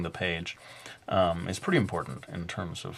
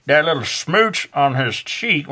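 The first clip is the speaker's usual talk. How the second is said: slow, higher drawling Southern accent; 5 semitones higher, 4 dB louder than usual. Shown as a demonstration. Art teacher slipping into Southern accent describing the kiss. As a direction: stretch out the smooch in a Southern drawl. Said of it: "am I doing, like, a Southern accent?".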